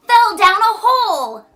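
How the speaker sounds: ordinary delivery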